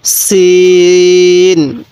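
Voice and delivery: সিন held very long, thin and firm s; thin hard sin. Shown as a demonstration. Teacher models Arabic sin, thin and hard, very slow. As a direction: draw out সিন with a thin sharp s. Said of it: "এই হরফটির নাম কি?".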